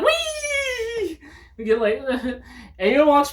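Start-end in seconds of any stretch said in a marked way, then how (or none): 0.0-1.2 high playful wee; mock gleeful squeal. Imagined waterfall jump, high gleeful squeal. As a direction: squeal like jumping off.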